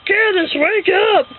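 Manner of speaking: high, slow, drawn-out wake-up call; dad as Santa. Retold or imitated. Dad shaking sleeping sister awake, high mock Santa call. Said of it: "He's like".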